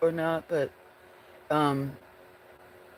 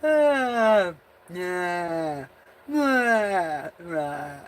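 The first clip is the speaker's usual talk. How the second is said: yawn-sneeze; 3 semitones higher, much slower than usual. Real, not acted.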